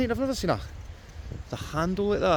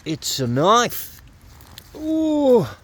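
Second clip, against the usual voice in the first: "It's a knife" said slowly and loud, then a high, loud "Oh"; stunned at finding a knife, voice jumps up; startled discovery; 8 semitones higher, 12 dB louder, much slower than usual. Real, not acted. Finds a knife while detecting.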